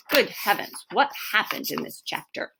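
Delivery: mock worried thinking aloud; mock fretful. Shown as a demonstration. Teacher acting puzzled over the chapter, mock fretful. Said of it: "I think".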